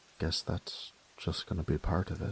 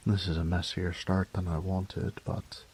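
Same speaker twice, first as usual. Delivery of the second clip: ordinary talk, nothing marked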